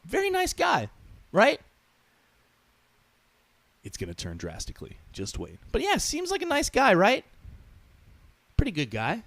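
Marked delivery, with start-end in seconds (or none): none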